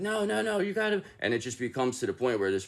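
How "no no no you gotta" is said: much higher, squeaky pleading no no no; high mock protest. The witch brushing off the man, high and pleading. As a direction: brush him off in a high put-on voice. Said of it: "this person's like".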